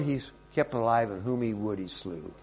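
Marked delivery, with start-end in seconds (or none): none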